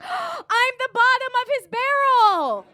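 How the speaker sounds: high, loud, slow gasping revelation; shocked realization. Retold or imitated. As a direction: realize it in horror.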